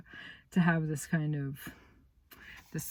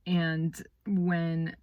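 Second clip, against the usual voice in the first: plain reading voice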